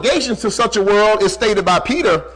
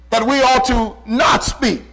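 ordinary delivery

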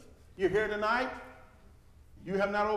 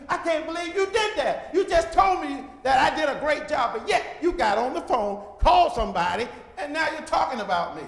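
loud, hurt and accusing; upset brother confronting the gossiper; indignant confrontation; 6 semitones higher, 8 dB louder than usual. Retold or imitated. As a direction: call them out for their two-faced talk.

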